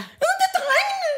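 put-on very high, whimpering child voice; teacher voicing a sobbing girl blaming the boys; little girl. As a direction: complain like a tearful small girl. Little girl quoted crying.

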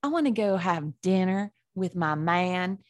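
drawling, hammed-up Southern accent. Shown as a demonstration. Co-host describing a night out, Southern accent. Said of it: "I have a really good one".

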